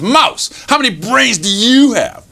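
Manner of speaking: high, swooping challenge on YOU; boastful fox. Acted. Fox challenges tiny mouse about brains, boastful. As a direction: call out mouse, then quiz grandly. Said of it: "And he says".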